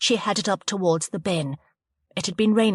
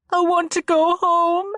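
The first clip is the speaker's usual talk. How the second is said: tearful; 12 semitones higher, 6 dB louder than usual. A narrator's reading. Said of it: "Chloe began to cry again".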